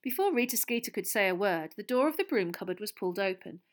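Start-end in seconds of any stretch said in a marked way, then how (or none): none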